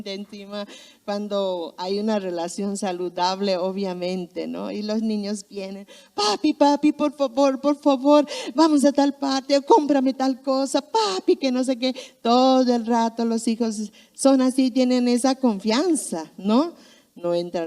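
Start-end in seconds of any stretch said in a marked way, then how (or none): 6.1-11.4 put-on higher, eager kid pleading; begging child. Small child begging dad for an outing, high and eager. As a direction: whine sweetly for a treat.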